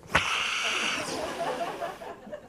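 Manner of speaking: long, exaggerated sniff; imitated sniffing. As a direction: mimic kids breathing in the smell of fresh copies. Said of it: "they always went like this".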